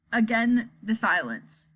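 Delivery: ordinary delivery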